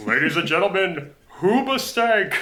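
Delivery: booming spooky announcer; low haunting voice. Acted. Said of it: "announcer on S N L as our spooky voice".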